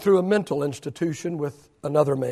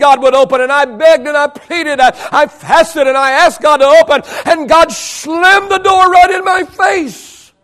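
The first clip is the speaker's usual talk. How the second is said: impassioned preaching; 9 semitones higher, 9 dB louder than usual. Real, not acted. Preacher telling the church of a shut door, impassioned. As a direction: preach it with force, stretching the climax.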